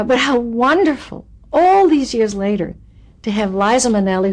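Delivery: usual speaking voice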